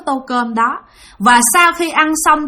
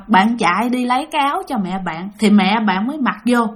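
ordinary delivery

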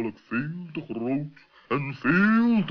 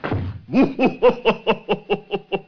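high, loud burst of laughter; high mocking laugh; 8 semitones higher, 4 dB louder than usual. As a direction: cackle at the blunder. Animal laughs at professor's mistake.

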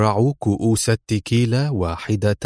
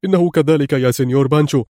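tense reply; 4 semitones higher, faster than usual. A narrator's reading. The henchman nervously assures Pancho, a touch raised. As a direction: hurry to reassure the boss. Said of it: "أجابه الرجل في توتر".

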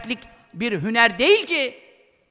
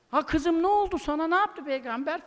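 higher, softer doting dad voice; mock a father fussing over his girl; hypothetical father pampering his daughter against the Prophet, in a doting mock voice; coddling father; 4 semitones higher, 7 dB quieter than usual.

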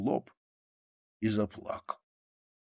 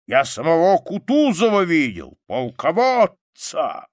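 put-on high, loud, excited old soldier; soldier's rousing war tale; loud bragging; 8 semitones higher, 7 dB louder than usual. A narrator's reading. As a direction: brag with rising pride, voice well up. Soldier boasts loudly of his service.

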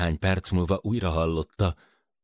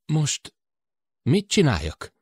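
helpless; 10 semitones higher than usual. Acted. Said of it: "Ope hangja őszintén tanácstalan volt".

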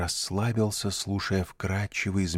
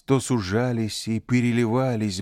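usual speaking voice

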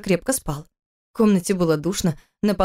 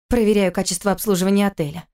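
plain reading voice

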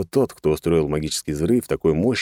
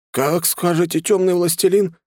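high-pitched, scared compliance; frightened; 13 semitones higher, 3 dB louder than usual. A narrator's reading. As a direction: agree fearfully in a high voice. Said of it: "испуганно проговорил мужик". (испуганно, высоким голосом)

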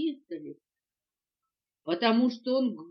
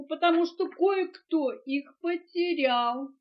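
high, slow tearful reproach; sobbing; 5 semitones higher, 6 dB louder than usual. A narrator's reading. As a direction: accuse tearfully, drawing it out. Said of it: "всхлипнула мартышка". (плаксиво, с упрёком)

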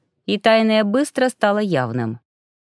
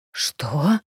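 panicked wheeze; 5 semitones lower than usual. Acted. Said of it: "задыхаясь от паники, прохрипел мужчина".

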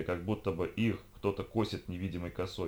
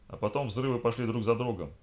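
ordinary delivery, nothing marked

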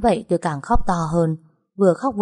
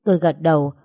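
usual speaking voice

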